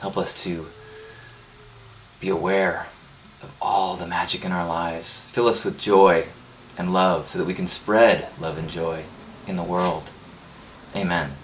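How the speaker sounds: soft, hushed prayer voice. Acted. Leading the congregation in a closing prayer, hushed.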